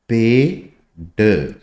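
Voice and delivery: पेड drawn out very slowly; stretched demo. Shown as a demonstration. Teacher slowly showing पेड to learners. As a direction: let the vowel in पेड ring long. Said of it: "ये देखो".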